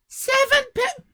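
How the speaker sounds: very high, cut-off gasp at the price; mock shock. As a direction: choke on the price. Shocked at seven pounds.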